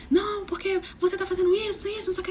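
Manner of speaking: high-pitched retold protest; mock arguing man. Man arguing with his partner on the metro, mock protest. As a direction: imitate a man complaining mid-quarrel.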